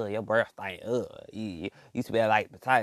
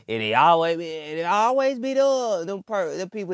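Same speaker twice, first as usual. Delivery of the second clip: mocking whine; 6 semitones higher than usual. Mocking preachy recruiters.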